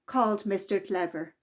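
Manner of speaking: lively, expressive read-aloud voice; children's story reading. A narrator's reading. Narrator reading a Mister Men story to children, lively and expressive. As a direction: read it to a room of small children. Reads picture book aloud.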